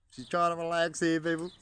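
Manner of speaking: high, loud telling off; playful loud reprimand to the birds; mock scold. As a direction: shoo them away for her. Voicing bird defending hen.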